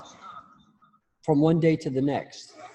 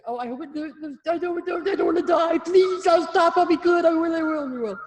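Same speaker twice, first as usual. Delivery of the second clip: much higher, louder squeaky begging; mock terrified pleading; 7 dB louder than usual. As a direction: play a cornered rabbit pleading for its life. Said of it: "like a rabbit, freeze right there and say".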